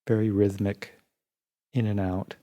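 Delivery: even, unhurried meditation voice with long pauses; guided meditation. Real, not acted. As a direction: guide listeners calmly through the body, leaving space between phrases.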